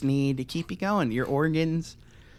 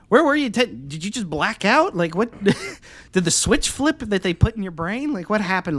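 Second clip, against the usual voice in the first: high, loud disbelief at a friend; incredulous mocking quote; 6 semitones higher, 4 dB louder than usual. Mocking a friend who now trusts government, loud disbelief. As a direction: pitch it up, loud and baffled. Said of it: "I'm like".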